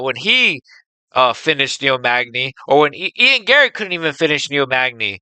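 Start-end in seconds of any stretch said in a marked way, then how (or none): none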